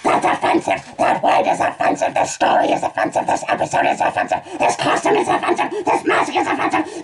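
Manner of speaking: loud, frantic outburst climbing high; outraged rant. Acted. Reader breaks off to denounce his own story, outraged.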